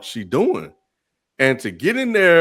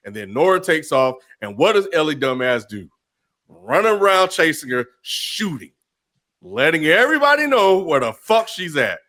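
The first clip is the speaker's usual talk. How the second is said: usual speaking voice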